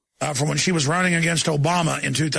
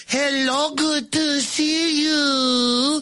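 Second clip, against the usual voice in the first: high, drawn-out fake Spanish accent; mock Spanish accent; 8 semitones higher, much slower than usual. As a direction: exaggerate a pandering Spanish-accented greeting. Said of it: "He wasn't speaking Spanish. He was going".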